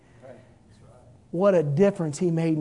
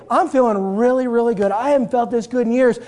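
healed woman glowing; 6 semitones higher, 7 dB louder than usual. Acted. Healed woman answers happily.

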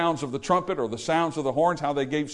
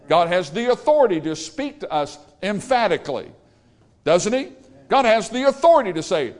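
emphatic, raised preacher's voice; forceful preaching; 3 semitones higher, 3 dB louder than usual. Real, not acted. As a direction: drive each point home with authority to a congregation.

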